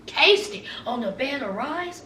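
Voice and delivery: normal talking voice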